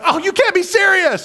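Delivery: fan's high-pitched outraged yell. Retold or imitated. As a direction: throw it at the screen in disbelief. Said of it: "I yell at the T V".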